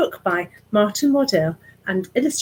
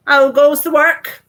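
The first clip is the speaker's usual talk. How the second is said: loud slow bossy call; farmer calling out; 7 semitones higher, 11 dB louder than usual. Acted. Lazy farmer calling from his bed, loud and slow. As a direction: bellow it out like a lazy boss.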